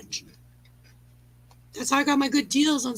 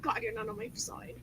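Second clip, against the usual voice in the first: whispered aside; 3 semitones lower, 15 dB quieter than usual. Real, not acted. Aside after Steve doubts her.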